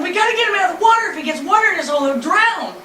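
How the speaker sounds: high, loud and fast urgent shouting; retold frantic yell. Wife yelling to boatman to lift husband out, frantic shout. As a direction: yell at the passing boat to save him. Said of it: "and I'm yelling".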